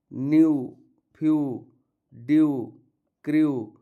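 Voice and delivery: "न्यू" "फ्यू" said with a long yu ending; ew as u demo. Shown as a demonstration. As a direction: stretch the yu sound in each word. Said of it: "तो उच्चारण होगा यू". ("यू" ध्वनि को साफ़ खींचकर बोलना)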